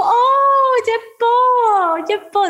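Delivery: very high, loud cooing of pretty; cooing idol. Retold or imitated. Idol cooing at a blushing fan, very high. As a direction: coo it like praising a baby. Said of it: "me dijo".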